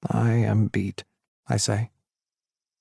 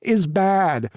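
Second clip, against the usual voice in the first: higher, whined out and stretched; exaggerated whiny drawl; 7 semitones higher than usual. A narrator's reading. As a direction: whine the word out dramatically. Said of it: "He whines it out of his mouth in an exaggerated drawl".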